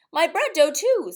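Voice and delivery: put-on high, loud little-girl call; eager child. A narrator's reading. Mary Lou telling mother about her dough, high and loud. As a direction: chime in with a proud squeal.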